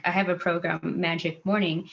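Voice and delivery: ordinary delivery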